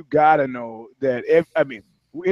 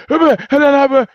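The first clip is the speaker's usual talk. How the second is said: much higher and louder flustered babble; babbling; 11 semitones higher, 6 dB louder than usual. Retold or imitated. Rattled shooter babbles, higher and louder nonsense. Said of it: "the guy just doesn't hear it".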